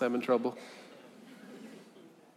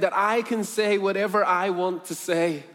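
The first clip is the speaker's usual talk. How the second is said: put-on higher, louder, astonished girl; amazed questioning; 6 semitones higher, 6 dB louder than usual. Freed slave girl questions her buyer, amazed. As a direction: ask it in rising disbelief.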